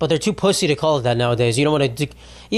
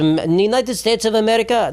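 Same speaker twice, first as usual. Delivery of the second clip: higher-pitched imitation Iranian accent; 6 semitones higher than usual. Comic as an Iranian saying America's name, Iranian accent. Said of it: "You said it with your accent".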